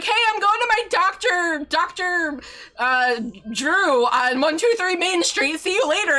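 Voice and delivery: high, loud breezy dad impression; a hint of a cheerful oblivious dad; imitating dad. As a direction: daughter mimics dad announcing his address. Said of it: "He's like".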